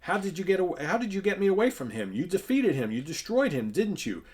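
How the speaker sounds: high, loud damsel questioning her rescuer; amazed heroine. Acted. Voices Sally questioning her rescuer.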